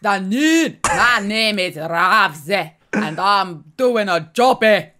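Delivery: loud, slow put-on Scottish; slow, booming fake Scottish accent; hammed-up Scottish accent. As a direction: belt out an over-the-top Scots impression. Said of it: "I do an amazing Scottish accent".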